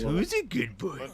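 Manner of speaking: slow, high, sing-song praise to a pet; SpongeBob pet voice. Retold or imitated. Imitating SpongeBob praising Gary, slow sing-song coo. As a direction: coo it slowly like SpongeBob to his snail. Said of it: "He says that to Gary".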